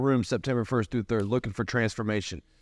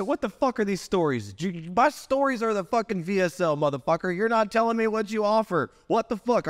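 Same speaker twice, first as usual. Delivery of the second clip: higher, pushed retold rant; retold yelling coach; 7 semitones higher than usual. Lee retelling the coach ripping his stories, heated mock rant. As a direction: rail at him like an angry coach. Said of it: "He said".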